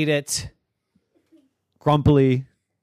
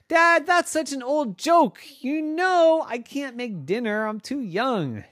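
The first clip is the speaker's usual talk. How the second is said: put-on high exasperated child voice; high kid voice; 11 semitones higher than usual. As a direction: play the fed-up little girl. Piper voiced.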